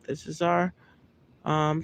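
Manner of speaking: ordinary talk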